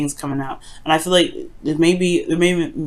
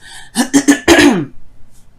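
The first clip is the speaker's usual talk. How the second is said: phlegmy throat clearing; 9 semitones higher, 7 dB louder than usual. Real, not acted. Throat clearing during reading.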